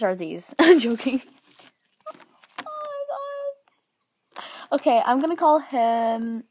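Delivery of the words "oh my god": much higher, very slow; drawn-out squeal. Real, not acted. Overwhelmed by the toy, long high squeal.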